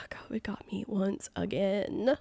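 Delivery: whispered after a sigh, defeated; resigned whisper. Real, not acted. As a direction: breathe it out, beaten again. Sigh then resigned aside.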